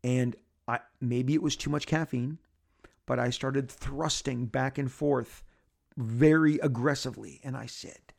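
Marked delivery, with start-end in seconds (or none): none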